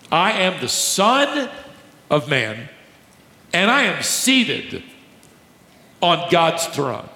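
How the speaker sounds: slow, high proclamation; solemn declaration. Acted. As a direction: proclaim each word with weight. Preacher voices Jesus' claim.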